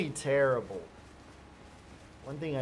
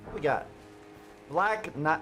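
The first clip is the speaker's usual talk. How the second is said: normal talking voice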